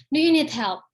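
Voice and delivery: "Do you need help?" loud, rising then falling on "you"; irritated, pointed question; annoyed rise-fall. Shown as a demonstration. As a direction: snap it out, fed up with the person. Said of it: "raising falling intonation when you are annoyed".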